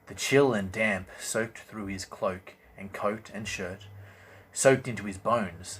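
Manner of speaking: ordinary delivery, nothing marked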